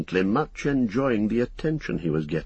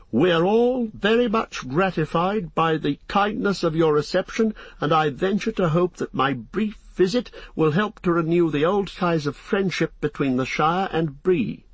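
polite, higher-pitched speech of thanks; formal speech; 7 semitones higher than usual. A narrator's reading. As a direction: address the room with careful courtesy. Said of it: "He spoke a few very suitable words".

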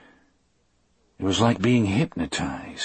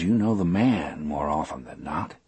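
plain reading voice

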